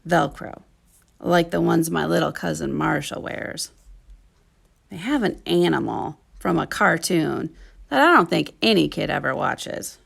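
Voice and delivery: ordinary delivery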